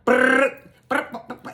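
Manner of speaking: high trilled bursts of gunfire; imitated rapid gunfire. Storyteller shows Roland lighting it up, imitated rapid gunfire. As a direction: roll out machine-gun brrrs, first one loudest. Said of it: "It sound like Vietnam".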